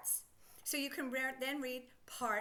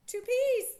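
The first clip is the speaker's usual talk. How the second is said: Two P's much higher and louder; excited; 10 semitones higher, 10 dB louder than usual. Real, not acted. Teacher pointing out the letters, high and loud. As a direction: exclaim it like a discovery.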